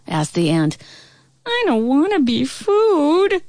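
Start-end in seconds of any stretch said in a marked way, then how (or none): 1.5-3.4 high, slow pleading wail; crying fly voice. A narrator's reading. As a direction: plead like a scared little fly. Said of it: "cried the fly".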